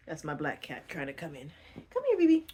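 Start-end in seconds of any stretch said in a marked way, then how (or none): 1.9-2.5 high, louder pet-calling voice; high coaxing call to the cat. Real, not acted. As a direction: sing out to the cat to come in.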